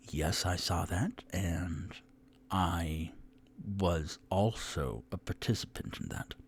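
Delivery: confiding whisper; quietly owning up to the fire. Acted. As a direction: let the confession slip out under your breath. Admitting secret role in fire.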